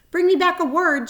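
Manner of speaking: high, loud call after him. A narrator's reading. Spider calls after the departing rat, loud and high. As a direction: call out to someone leaving. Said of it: "Charlotte called after him".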